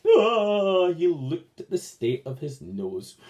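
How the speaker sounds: ordinary delivery, nothing marked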